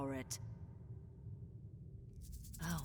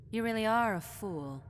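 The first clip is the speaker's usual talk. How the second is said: scornful; 3 semitones higher, 6 dB louder than usual. Acted. Scornful verdict.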